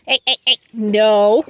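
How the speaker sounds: loud warning hey hey hey no; sharp shout. Real, not acted. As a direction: stop the horse with a loud sharp call. Scolding horse grabbing hose.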